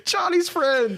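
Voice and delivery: very high mock cry; hammed-up high-pitched wail; mock scream. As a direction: shriek it like the delayed scream. Mimicking Sonya's scream.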